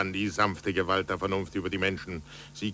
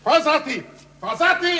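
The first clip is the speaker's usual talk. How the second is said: loud, very high calling; urgent, excited call across the house; 8 dB louder than usual. Acted. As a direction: shout for someone in another room. Calling out for the servant.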